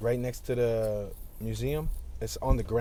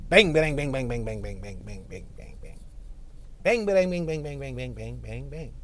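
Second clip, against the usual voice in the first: beatboxing bangs. Acted. Making beats over a dirt bike ride, fading bangs. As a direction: beat out the bangs, then let them fade. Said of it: "That's me making beats with my dirt bike".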